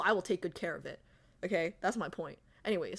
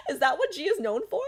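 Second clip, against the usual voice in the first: laughing; 7 semitones higher, faster than usual. Real, not acted. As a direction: still laughing, asking again. Repeats through laughter.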